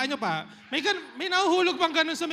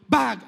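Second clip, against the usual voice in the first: loud thud; 7 dB louder than usual. Shown as a demonstration. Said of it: "Sa second floor".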